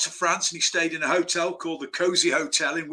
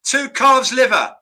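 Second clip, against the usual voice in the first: loud, high, drawn-out order in imitation; Michael Caine; 7 semitones higher, 7 dB louder, slower than usual. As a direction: mimic the film star ordering emphatically. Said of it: "do my Michael Caine impression".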